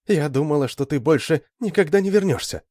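put-on high, plaintive girl's lament; mildly acted tearful distress. A narrator's reading. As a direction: lift the pitch, a touch of weeping. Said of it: "плакала она".

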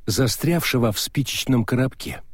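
ordinary delivery, nothing marked

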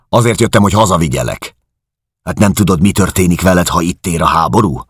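bully her into coming home; angry shouting. Acted. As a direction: brother shouting at his sister. Georg shouting at sister.

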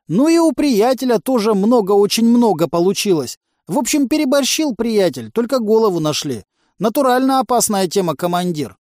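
high, emphatic cautionary tale; agitated junkie underling. A narrator's reading. Junkie warning the commander about a friend's accident, agitated. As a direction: tell the grisly story pitched well up.

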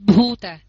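Bhuta with voiced b and breath, loud; correct Bhuta. Shown as a demonstration. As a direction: model Bhuta firmly. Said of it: "chứ không phải là bơ và hơ nữa". (đọc mẫu Bhuta)

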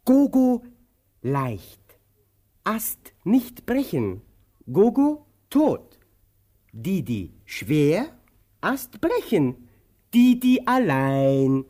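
put-on slow, choppy words as to a child; slow, simple, patient explanation; slow spelling out. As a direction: break it down word by word.

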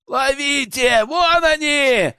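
high, drawn-out cry; calling out. A narrator's reading. Poachers calling behind fleeing prisoners, high drawn-out cry. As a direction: call after fugitives, stretching the last words. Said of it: "донеслось в спину".

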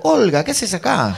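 high, slow, stretched surprise; astonished recognition. Retold or imitated. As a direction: act out being stunned to meet an acquaintance. Reconoce sorprendido a Olga. (sorpresa exagerada, aguda y alargada)

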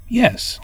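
Yes pitched high. Shown as a demonstration. Teacher shows a high intonation on Yes. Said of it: "overlay various intonations on words".

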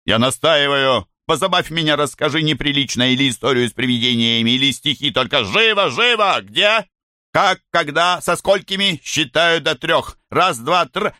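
domineering, hurrying him on; imperious command. Acted. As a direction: bark orders at a cowering victim. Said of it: "Белло повелительно".

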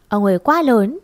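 usual speaking voice

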